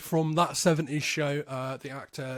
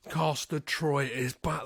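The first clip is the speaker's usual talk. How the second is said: normal talking voice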